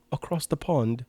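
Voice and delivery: lightly put-on British accent. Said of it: "said with a British accent".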